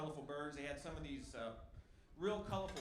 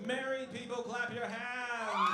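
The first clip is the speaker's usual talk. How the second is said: loud, higher call to the audience; rally the room to clap; crowd call; 6 semitones higher, 4 dB louder, slower than usual. Real, not acted. Comic shouts at the audience to clap, loud. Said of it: "round of applause".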